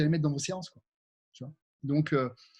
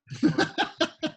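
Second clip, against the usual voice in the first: laugh; 12 semitones higher than usual. Real, not acted. Laughs at nose-blowing joke.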